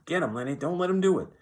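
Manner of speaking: high, loud urging yell. A narrator's reading. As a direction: yell it out, rising to a peak.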